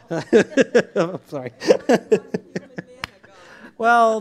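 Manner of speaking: slow, loud, giggling sorry; laughing apology. Real, not acted. Preacher apologises to the room, still laughing loudly. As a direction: apologise while still cracking up. Cracks up after joke.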